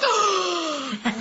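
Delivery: big, high mock gasp; excited gasp. Guest re-enacting hearing about Funimation, excited gasp. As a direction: gasp in delighted surprise. Acting out her own excited reaction.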